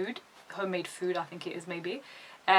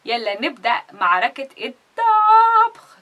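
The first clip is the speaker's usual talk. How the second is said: mock announcer call; 5 semitones higher, 8 dB louder than usual. Repeating host's battle call.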